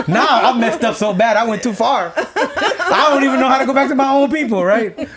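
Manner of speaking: high, loud laughing telling. Real, not acted. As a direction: laugh through the whole story, voice up high. Laughing at his own story.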